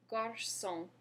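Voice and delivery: slow model of Garçon; Garçon, ends on a nasal on; French garçon. Shown as a demonstration. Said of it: "Go ahead and say garçon".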